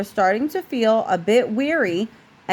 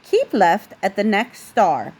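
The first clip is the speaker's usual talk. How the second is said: usual speaking voice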